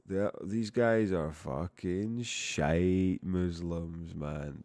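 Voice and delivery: slow, drawn-out mock Scottish; mock Scottish, lingering on the joke. Teasing viewers about Scotland, slow mock Scottish delivery. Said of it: "if you said that in Scotland".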